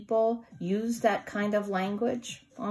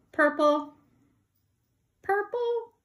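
each purple higher and louder; rising singsong; 13 semitones higher, 7 dB louder than usual. Shown as a demonstration. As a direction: climb up to a squeal. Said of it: "So here's purple".

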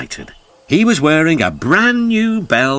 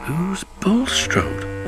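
soft, slow, higher question; mildly puzzled wondering; 6 semitones higher, 7 dB quieter, much slower than usual. A narrator's reading. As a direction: ask it slowly and quietly, puzzled. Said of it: "wondered Percy".

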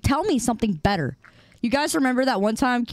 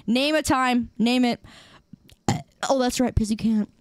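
normal talking voice

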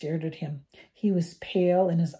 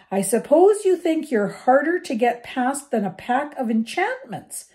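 angry, higher and louder telling-off; stormy scolding; 8 semitones higher, 7 dB louder than usual. A narrator's reading. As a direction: storm at the boys. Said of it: "she stormed".

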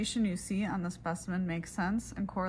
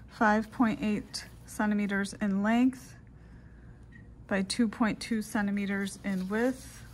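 dictating. Shown as a demonstration. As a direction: dictate it clearly into the recorder. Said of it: "I will go ahead and do those steps in a dictation format".

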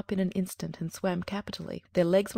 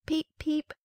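high, squeaky little peep; tiny chick peep; 10 semitones higher than usual. A narrator's reading. Hatchlings cry out as their eggshells burst, high peeping. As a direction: peep like a newly hatched duckling. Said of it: "it cried".

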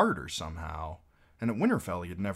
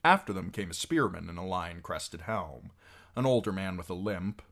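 plain reading voice

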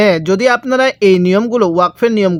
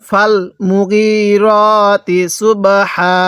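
মুগিরতি drawn out on long "ee" and "o" vowels; slow Quran recitation; much slower than usual. Shown as a demonstration. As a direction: chant it very slowly, holding each long vowel. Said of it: "আমার সাথে পড়ুন".

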